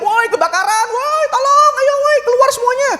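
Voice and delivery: hammed-up fire alarm shout; panicked shouting. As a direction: scream fire like a panicked bystander. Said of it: "teriak-teriak".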